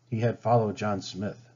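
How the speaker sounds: plain reading voice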